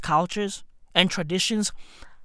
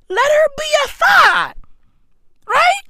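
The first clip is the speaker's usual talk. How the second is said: shout; 7 dB louder than usual. Real, not acted. Ranting about letting girls choose.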